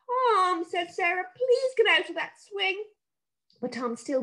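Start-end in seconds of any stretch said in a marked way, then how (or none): 0.1-2.8 put-on higher, begging girl's voice; a worried sister begging her brother; pleading sister. A narrator's reading.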